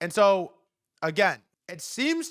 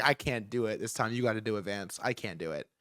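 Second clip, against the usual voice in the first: weary handover; 5 semitones lower, 5 dB quieter than usual. Retold or imitated. Voicing Trump handing over to Vance.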